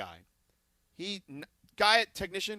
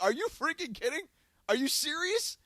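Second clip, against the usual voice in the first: much higher, half-laughing disbelief; incredulous; 10 semitones higher than usual. Retold or imitated. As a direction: ask as if you can't believe it, rising at the end. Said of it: "I literally lost it at that point".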